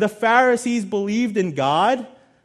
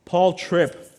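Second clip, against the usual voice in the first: normal talking voice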